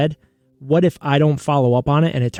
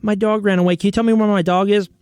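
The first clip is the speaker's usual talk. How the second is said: higher, earnest mock plea about a lost dog; mock pet owner; 7 semitones higher than usual. Parodying the show's question to the psychic, pitched-up mock plea. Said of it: "It's just like".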